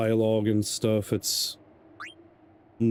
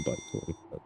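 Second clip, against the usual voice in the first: whispered; 10 semitones lower, 12 dB quieter, faster than usual. Real, not acted. Muttering a game choice aloud.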